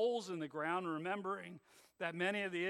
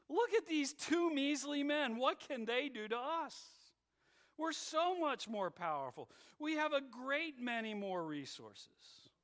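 scornful; 7 semitones higher than usual. Acted. World's scornful voice.

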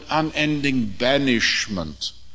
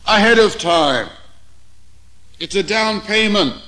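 raised, ringing preacher voice; preaching; 7 semitones higher, 4 dB louder than usual. Real, not acted. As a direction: preach it out with full force. Preaching at full force.

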